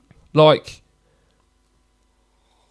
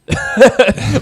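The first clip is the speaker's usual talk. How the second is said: sudden high burst of laughter; 12 semitones higher, 6 dB louder than usual. Real, not acted. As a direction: crack up loud and high. Laughing at his own joke.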